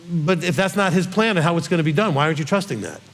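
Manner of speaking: ordinary delivery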